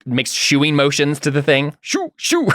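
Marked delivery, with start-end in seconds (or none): 1.8-2.5 very high, short shoos; high-pitched shoo noises. Acted. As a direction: chase a critter off in a high voice. Git shoos the creature away.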